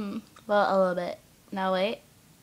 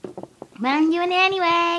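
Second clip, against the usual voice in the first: higher, louder and slow, gleeful defiance; defiant glee; 9 semitones higher, 6 dB louder than usual. Real, not acted. Vlogger defying her boyfriend's helium ban, gleeful and loud. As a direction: announce the rebellion with cheeky relish.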